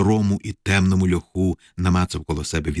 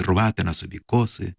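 plain reading voice

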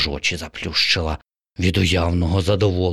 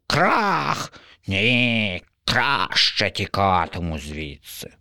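slow, higher grumbling crow; complaining crow; 5 semitones higher, slower than usual. Acted. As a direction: list the woes with disgust.

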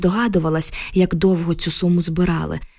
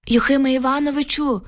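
calling out; 6 semitones higher than usual. A narrator's reading. Oksana calls the neighbour at his gate, calling out. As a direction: call his name across the yard. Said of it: "покликала Оксана".

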